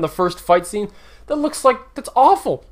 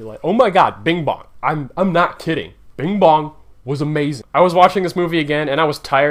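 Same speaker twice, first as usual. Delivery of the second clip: normal talking voice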